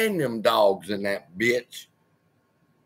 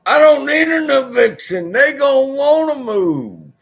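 much higher, louder boast; hyped shout; 12 semitones higher, 8 dB louder than usual. Real, not acted. Bragging to viewers about scaring tenants off, loud hype. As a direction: belt it out triumphant.

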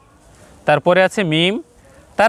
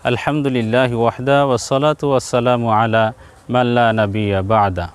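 Arabic recitation; 8 semitones lower than usual. Acted. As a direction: recite the opening formula devoutly. Arabic greeting and praise recited. (আরবি সালাম ও হামদ ধীরে আবৃত্তি)

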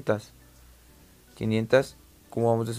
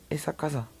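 whispering; 3 semitones higher, 7 dB quieter than usual. Real, not acted. Awed at the space station.

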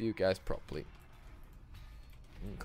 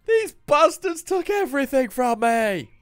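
higher, louder, slowed hammed-up wrath; mock vengeful hero; 13 semitones higher, 9 dB louder than usual. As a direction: ham up a wronged avenger. Mock revenge declaration.

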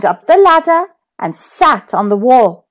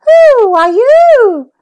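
high, slow and loud little cat; 10 semitones higher, 5 dB louder, slower than usual. A narrator's reading. The cat asking Humpty, high and slow. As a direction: ask it like a wide-eyed kitten.

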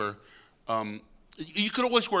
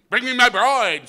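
much higher, loud bellowing command; blustering general; 9 semitones higher, 11 dB louder than usual. Retold or imitated. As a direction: roar for the bride like a vain soldier. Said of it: "Miles Glorious went".

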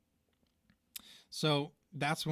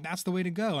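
excited cheer; 6 semitones higher, 6 dB louder, faster than usual. Retold or imitated. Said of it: "He's like".

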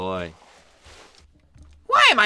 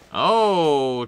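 normal talking voice

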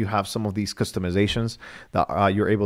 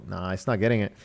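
ordinary talk

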